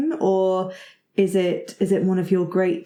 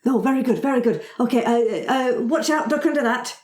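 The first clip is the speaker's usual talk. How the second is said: dwarf calling out; 6 semitones higher than usual. Acted. Dwarf urging party mid-fight.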